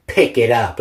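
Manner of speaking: mock mom order. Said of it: "she's like".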